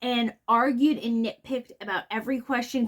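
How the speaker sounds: normal talking voice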